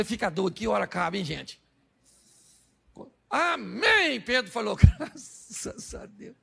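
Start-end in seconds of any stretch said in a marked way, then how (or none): 3.3-4.2 high, swooping amen; exaggerated amen, pitched well up; acted eager amen. Peter's eager amen at sermon's end. 4.8-6.3 slow, whispered thanks; acted relief. Peter relieved sermon is over.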